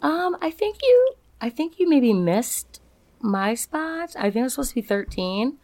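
high, hesitant, meek voice; politely nervous complainer; timid complaint. Retold or imitated. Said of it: "he comes up to me and he's like".

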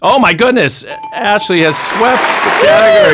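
high, loud delighted announcement; excited exclamation. Real, not acted. Host celebrating the contestant sweeping the category, excited and loud. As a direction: cheer her on like a game show host.

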